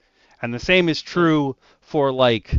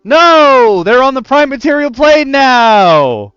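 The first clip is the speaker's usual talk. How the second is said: much higher, loud, slow put-on shout; alarmed yell; 13 semitones higher, 12 dB louder, slower than usual. Valley of Aegiri warning the party, alarmed yell. Player character yells warning.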